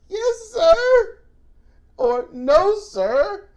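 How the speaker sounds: high, loud crying yelp of yes sir; desperate yes sir. Retold or imitated. Crying out to the cop in pain. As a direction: cry out in pained submission. Begging cop while being abused.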